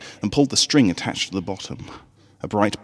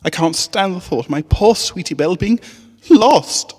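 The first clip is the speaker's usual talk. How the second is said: stifled sniffle; 10 semitones higher, 6 dB louder than usual. A narrator's reading. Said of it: "stifling a sniffle".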